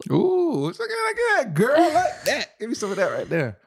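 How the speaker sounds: very high, loud, drawn-out falsetto cheer; over-the-top hyping. Real, not acted. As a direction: whoop her on in a stretched squeal. Hyping up partner's teammate line.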